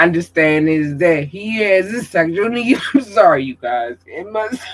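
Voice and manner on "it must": choking mid-sentence; words cut off by choking. Real, not acted. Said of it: "I got to choking".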